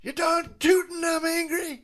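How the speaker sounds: very high mock-angry cartoon voice; Ren. Host as Ren answering his friend, mock-angry squeal. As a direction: squeeze the anger up into a high imitation. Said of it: "Ren, are you mad?".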